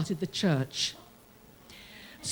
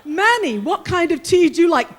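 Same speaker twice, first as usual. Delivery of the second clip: cheerful call; 5 semitones higher, 8 dB louder than usual. Retold or imitated. Calling to an inmate, laughing.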